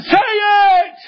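sudden high, drawn-out shout; screamed command. Retold or imitated. Preacher urging a mumbling boy to speak up, high screamed command. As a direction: explode upward into a hollered order. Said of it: "I can scream at you".

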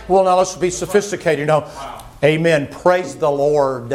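mock sophisticated. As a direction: ham up a prim, proper churchgoer. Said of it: "making sure I say every syllable".

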